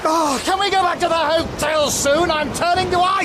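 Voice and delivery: calling over wind. Acted. Freezing friend calling over the wind to go back.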